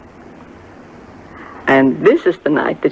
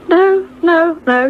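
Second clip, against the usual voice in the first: high protesting denial; 12 semitones higher, slower than usual. Retold or imitated. Gladys denies she is crying.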